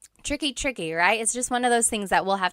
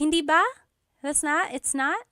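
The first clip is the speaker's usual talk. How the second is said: higher, questioning disbelief; acted surprise; 7 semitones higher than usual. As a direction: query it in mild surprise, voice lifted. Acted surprised reply about wrong tickets.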